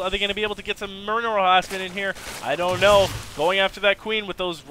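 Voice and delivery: loud, high, fast hype over the fight; excited shouting. Real, not acted. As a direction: yell it like the fight just broke out. Caster hyped by a fight.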